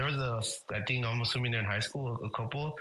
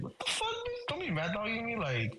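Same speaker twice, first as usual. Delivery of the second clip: high-pitched incredulous "what the fuck"; mock angry retort; 6 semitones higher than usual. Retelling telling off the mad-dogging boyfriend, mock angry. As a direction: pitch up and fire back at the guy.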